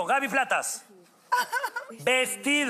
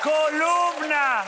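stretched clue word; 3 semitones higher, much slower than usual. Acted. Host reads clue drawn out.

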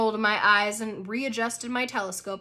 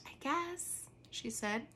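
shy; 9 dB quieter than usual. A narrator's reading. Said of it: "She blushed a little".

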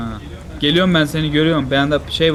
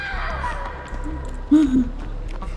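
high squealing laugh; 6 dB quieter than usual. Real, not acted. Laughs at friend.

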